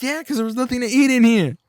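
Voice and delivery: high, loud mocking dad voice. The dad in the video jeering at his son, mocking. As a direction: jeer it back at the kid. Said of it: "It's like".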